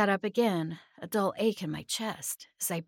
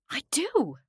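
high, eager reply; quick answer; 8 semitones higher, 4 dB louder than usual. A narrator's reading. Narrator insisting to the being they love friends, quick reply. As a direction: answer straight back, pitched up. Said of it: "I answered back quickly".

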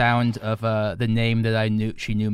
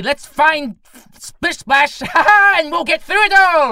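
very high, loud character voice; high rallying character; 9 dB louder, slower than usual. Acted. Adventurer rallying friends after a death, high character voice. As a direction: cheer them on in a high loud squeal.

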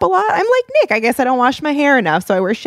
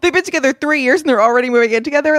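mock scandalized judgment; 6 semitones higher, much faster than usual. Mocking people who say couples are rushing into something, mock scandalized judgment. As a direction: imitate someone appalled at a couple rushing in. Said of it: "act like other people are rushing into something".

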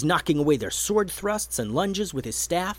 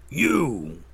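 troll yell. A narrator's reading. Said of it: "yelled one of the trolls".

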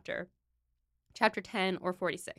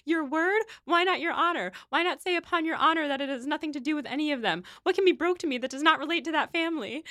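higher and insistent; indignant quote; 7 semitones higher than usual. Retold or imitated. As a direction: challenge him, voice pitched up. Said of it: "And she goes".

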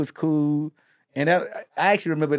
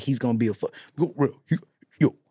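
quoting Charlie Mack; faster than usual. Retold or imitated. Charlie Mack quoted hyping [name].

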